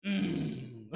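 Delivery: long drawn-out Chungcheong "mmm"; acted Chungcheong wordless standoff; Chungcheong driver's grunt. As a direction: hum it out slowly like a peeved Chungcheong driver. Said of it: "뭐라 하는 줄 압니까".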